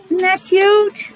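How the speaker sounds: plain reading voice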